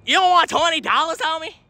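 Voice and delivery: can't believe they refuse, pitch it up high; incredulous pitch. Real, not acted. Pleading with a stranger to take twenty dollars, high and incredulous.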